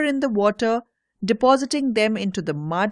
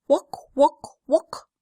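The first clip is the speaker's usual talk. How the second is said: higher, croaky frog calls rising at the end; frog chorus; 5 semitones higher, slower than usual. A narrator's reading. Frogs greeting the boys, lightly croaked frog chorus. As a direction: croak it like a pond full of frogs. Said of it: "They were treated to a chorus that went".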